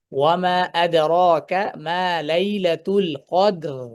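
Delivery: slow chanted ওয়া মা আদরাকা মা লাইলাতুল কদর; recitation. Shown as a demonstration. Teacher reciting the verse to students, slow chant. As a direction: recite the verse slowly and melodically. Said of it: "ঠিক আছে?".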